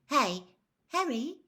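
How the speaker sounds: put-on high, light girlish call; a young girl calling to a schoolmate. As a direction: call his name brightly from behind. Cho calling to Harry.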